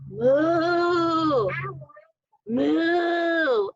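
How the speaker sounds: long, rounded moos; cow moo. Pretend. Parent asking what this is called, long cow moos. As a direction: draw out each playful moo.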